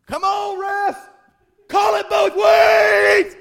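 hammed-up sports-parent yelling; mock yell at ref. Preacher imitating a parent yelling at the ref: hammed-up shout. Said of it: "Yelling and standing up".

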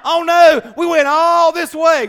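comic howl of dismay; mock despair. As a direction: wail it like a ruined road trip. Acted despairing Israelites.

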